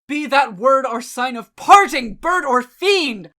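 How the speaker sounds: loud, high outburst; shrieked. A narrator's reading. Enraged man ordering the raven away, shrieking. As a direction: fling the words at the fiend. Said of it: "I shrieked".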